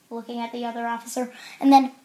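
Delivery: ordinary delivery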